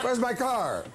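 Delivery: high, plaintive celebrity wail, imitated; indignant star imitation. Guest mimics Regis hunting for the car, high and frantic. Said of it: "he kept running up and down saying".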